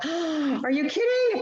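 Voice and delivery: high, squealing are-you-kidding surprise; amazed disbelief. Retold or imitated. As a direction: voice leaping up high in mock astonishment. Imitating amazed customers.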